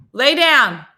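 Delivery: loud, high command to a dog; real yell. Real, not acted. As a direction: yell it so a deaf dog hears, without anger. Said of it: "He can't, I'm yelling".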